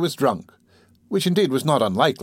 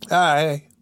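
usual speaking voice

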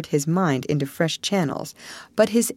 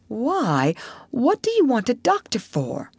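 higher puzzled rat; lightly squeaky surprise; twitchy squeaking rat voice; 6 semitones higher than usual. Acted.

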